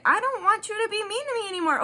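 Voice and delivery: high and fast, whiny plea; pleading high voice. Pretend. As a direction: rush it out in a little high whine. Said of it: "like, like".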